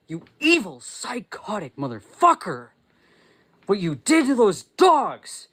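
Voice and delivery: enraged yelling, voice pitched high; real angry outburst. Real, not acted. Condemning someone who let dogs burn, in an angry outburst. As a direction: erupt in real rage, hammering each accusation. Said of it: "I get really fucking angry".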